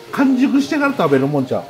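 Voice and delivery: ordinary talk, nothing marked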